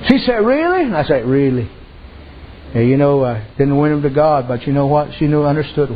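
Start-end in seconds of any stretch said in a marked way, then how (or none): 0.4-0.8 much higher, rising "Really?"; high rising disbelieving question; hopeful surprise. Retold or imitated. Woman asking hopefully, preacher answers. 1.3-1.7 lower, falling "Really."; low, steady reassuring reply; calm assurance. Retold or imitated. Preacher's calm answer.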